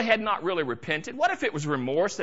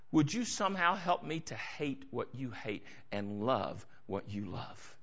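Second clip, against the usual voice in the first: much softer and lower, pleading; quiet prayer; 9 semitones lower, 9 dB quieter than usual. Acted. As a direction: murmur it to God alone. Voicing a quiet personal prayer.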